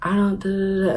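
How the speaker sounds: soft, lightly imitated upset voice; quietly plead, imitating a tearful kid; understated mock sob, trailing into filler; retold sobbing. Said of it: "I'm sobbing".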